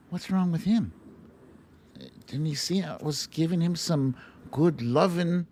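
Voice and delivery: high, offended animal voice; voicing the kangaroo. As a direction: sound hurt that the love was refused. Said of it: "he just looks at the camera".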